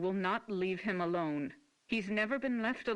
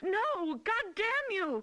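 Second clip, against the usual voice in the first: screaming; 13 semitones higher, slower than usual. Acted. Fighting being restrained.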